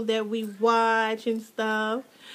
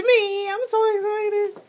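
excited squeal; 9 semitones higher, 3 dB louder than usual. Real, not acted. About to show gift.

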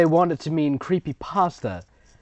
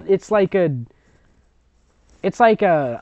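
normal talking voice

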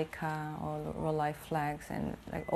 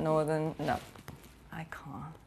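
ordinary delivery

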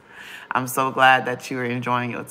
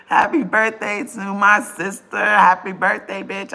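loud birthday cheer; 6 semitones higher, 6 dB louder than usual. Real, not acted. Birthday shoutout continues.

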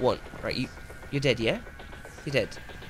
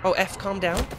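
ordinary talk

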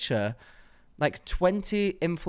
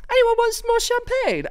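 much higher, brighter mock host voice; chirpy host pushing more bubbly; generous party host; 6 dB louder than usual. Imitating the friend offering everyone champagne, high mock host voice.

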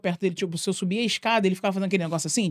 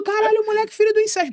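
much higher jeering chant; crowd taunt; 14 semitones higher, 4 dB louder than usual. Retold or imitated. Schoolkids jeering the boy in the corridor, high mocking chant. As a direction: jeer like the whole schoolyard.

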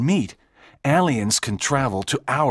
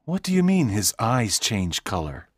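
mildly puzzled, in a lower voice; low character voice, a touch of surprise; surprised; 7 semitones lower than usual. Acted. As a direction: question it with understated surprise.

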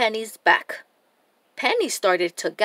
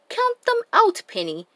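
high call, dropping on the name; 10 semitones higher than usual. A narrator's reading. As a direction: call encouragement to a friend. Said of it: "called Dora and Boots".

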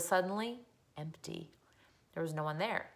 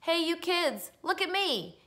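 put-on high-pitched call to other kids; 10 semitones higher, slower than usual. A narrator's reading. Boy to children who followed him home, calling out. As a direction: call out for their attention. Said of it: "he called to the children".

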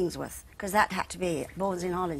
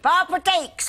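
high, loud cheeky "Oi"; loud call; 10 semitones higher, 7 dB louder than usual. Retold or imitated. Wardrobe mistress calling the girl over, loud and high. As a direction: summon her across the room. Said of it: "play that wardrobe mistress".

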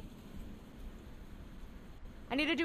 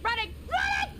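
panicked running; 6 semitones higher than usual. Real, not acted. Streamer fleeing a monster, frantic yell. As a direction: shout as you sprint away.

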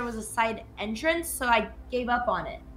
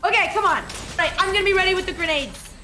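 hyped up; 7 semitones higher, faster than usual. Real, not acted. Hyping self up in game.

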